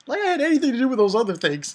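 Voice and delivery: high, loud, fast rush breaking into laughter; amused scoff. Acted. Reviewer mocks the rival's accusation, amused scoff. Scoffing aside after reading villain's quote.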